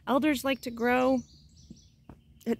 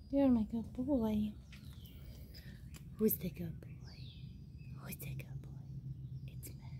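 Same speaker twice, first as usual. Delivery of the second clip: talking to a dog; 3 semitones lower, 10 dB quieter than usual. Real, not acted. Praising dog Max.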